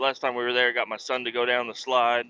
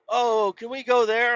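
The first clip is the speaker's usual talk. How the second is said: higher, lightly voiced eager kid; a hint of pleading child; imitating kids; 11 semitones higher than usual. Kids asking dad about the pool, mild eager plea. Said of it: "and they're like".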